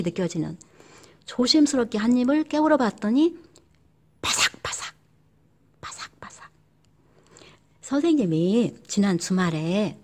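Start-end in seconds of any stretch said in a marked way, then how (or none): 4.2-6.5 whispered crunchy 바삭바삭; hushed crunch sound. Shown as a demonstration. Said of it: "귀에 바삭바삭".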